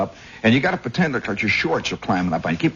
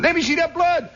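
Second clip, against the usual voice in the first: very high, strained yelling; imitated frenzied cockfight spectators; crowd hollering. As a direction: egg the bird on bloodthirstily. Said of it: "They keep hollering".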